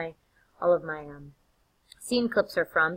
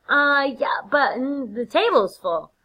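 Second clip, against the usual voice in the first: loud, high, awkward objection; stall, then object politely but loudly; hedging Macbeth; 7 semitones higher, 6 dB louder, slower than usual. Acted. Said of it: "Macbeth is like".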